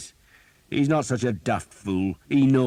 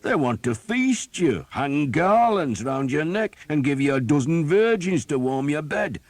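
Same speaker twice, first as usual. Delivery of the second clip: mock him without mercy; captain mocking a doomed archer; sarcastic scorn; 8 semitones higher, 8 dB louder than usual. A narrator's reading. Sarcastic tirade at Thomas.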